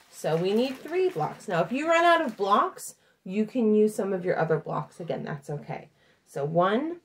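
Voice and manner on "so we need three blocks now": usual speaking voice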